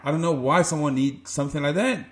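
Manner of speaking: usual speaking voice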